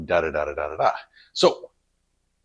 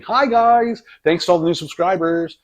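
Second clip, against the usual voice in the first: bright, higher, louder hello to viewers; thanking new subscribers, excited and louder; excited greeting; 8 semitones higher, 5 dB louder than usual. Real, not acted. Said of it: "this is me saying".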